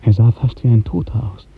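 usual speaking voice

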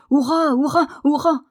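loud, high hurrahs; three exuberant shouts; shouted cheer. A narrator's reading. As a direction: cheer like a robust boy. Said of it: "il aurait probablement crié".

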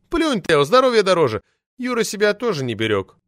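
shrill furious official lady yelling; roaring outrage. Acted. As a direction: howl it out like an enraged sabre-toothed tigress. Poetess advises the boss.

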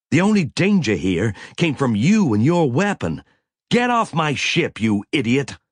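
loud furious rebuke; angry. A narrator's reading. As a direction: throw him off the ship. Captain furious at Cutler.

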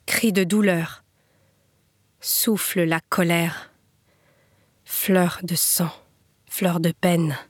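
measured verse with long pauses between short lines; memorial poem recitation. Acted. Reader honouring Rémi Fraisse's memory, measured poem recitation. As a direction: recite it gravely, line by line.

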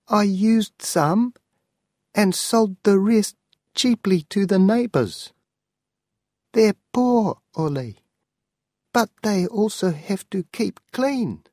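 high, pleading old aunt, voiced well above the narrator; an elderly aunt begging her nephew not to be angry. Aunt explains selling the books.

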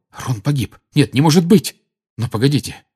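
ordinary delivery, nothing marked

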